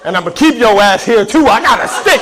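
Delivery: loud, high-pitched comic bluster; mock threat. Comic threatening a heckled audience member, mock-threatening shout.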